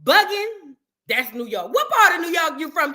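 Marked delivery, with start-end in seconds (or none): 0.0-0.7 "bugging" punched higher and louder; emphatic bugging. Retold or imitated. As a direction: hit "bugging" hard. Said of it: "Anybody who say".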